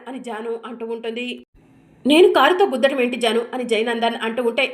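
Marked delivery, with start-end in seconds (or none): 2.0-3.4 loud, stunned protest; startled loud denial. Acted. Husband to Janu, a loud startled denial. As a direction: blurt it out in disbelief.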